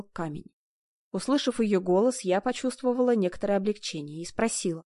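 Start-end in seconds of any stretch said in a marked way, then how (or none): none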